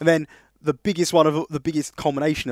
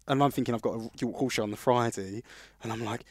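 ordinary talk

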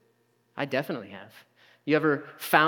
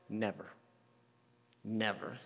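Never said very softly, low and falling; soft denial; 6 semitones lower, 13 dB quieter than usual. Acted. Peter quietly insisting to Jesus, a soft low Never. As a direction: let each Never drop to almost nothing.

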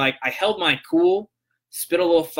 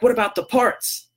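higher-pitched retold demand, rising at the end; indignant challenge; 7 semitones higher than usual. Angry customer challenges the agent about parts, indignant. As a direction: imitate a customer demanding an answer.

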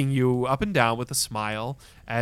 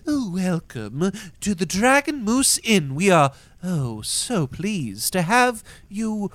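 high, effusive welcome with swooping pitch; gracious inn greeter; 7 semitones higher, 4 dB louder than usual. Acted. Inn host welcoming a group of travelers, effusive. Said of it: "bows to you and says".

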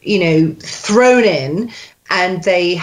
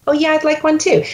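lightly voiced Phoebe echo, much higher; parroting Phoebe; 8 semitones higher, faster than usual. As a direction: repeat her line like a parrot, pitched up. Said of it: "you start as the actor".

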